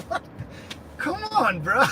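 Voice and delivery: high, incredulous "what do you mean"; a jump in pitch, like you can't believe it; surprised disbelief. Retold or imitated. Retold pleading with friend.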